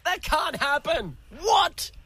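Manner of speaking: high mock disbelief, sharp rising What; over-the-top surprise. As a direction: play fake shock way up high. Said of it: "I do another one".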